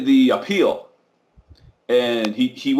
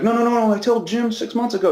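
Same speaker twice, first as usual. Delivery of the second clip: urgent protest; 8 semitones higher, faster than usual. Retold or imitated. Cousin protesting to the elders.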